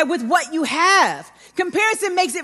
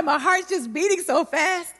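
ordinary talk, nothing marked

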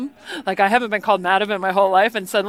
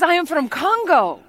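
higher-pitched, badly faked Congolese accent; mock Congolese accent; 6 semitones higher than usual. Tea lady names her country, mock Congolese accent. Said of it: "her accent sounded nothing like this".

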